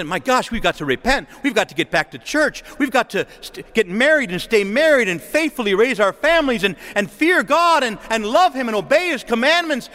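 a rallying plea that climbs as it goes; urgent resolve. Acted. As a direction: build an impassioned call to repent. Imagined cry of repentance.

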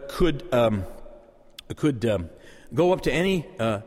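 normal talking voice

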